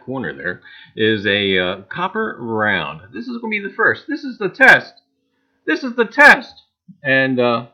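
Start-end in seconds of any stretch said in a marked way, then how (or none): 4.1-5.0 high, loud announcement; announcing the test. Acted. Announcing the first giveaway test. 5.6-6.6 high, loud announcement; announcing the test. Acted. Announcing the first giveaway test.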